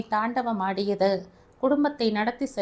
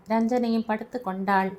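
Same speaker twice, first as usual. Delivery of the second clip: plain reading voice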